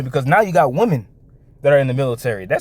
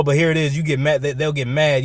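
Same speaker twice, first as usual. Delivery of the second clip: normal talking voice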